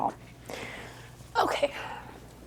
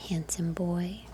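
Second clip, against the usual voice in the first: tender whisper; 3 semitones lower, 10 dB quieter, slower than usual. Real, not acted. Photographer praising sleeping newborn, tender whisper. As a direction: murmur it lovingly. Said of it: "cooing at newborn".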